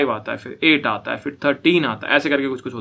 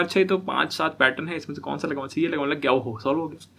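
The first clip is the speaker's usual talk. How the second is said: much softer, musing to yourself; mock inner monologue; 8 dB quieter than usual. Student musing over which pattern to use, quietly. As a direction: think it through under your breath.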